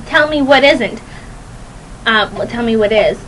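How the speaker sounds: normal talking voice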